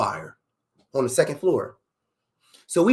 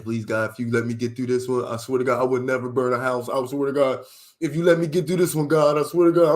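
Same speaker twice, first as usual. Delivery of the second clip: tearful praying. Retold or imitated. Re-enacting himself begging God to save him, mock-tearful praying. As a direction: beg God as if crying, imitated. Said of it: "I'm in that motherfucker room crying, praying".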